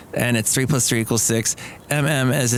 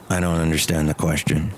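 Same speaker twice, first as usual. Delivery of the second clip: deep character voice; 8 semitones lower than usual. Acted. Engineer character answering the host in a low voice. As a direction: drop deep and deadpan.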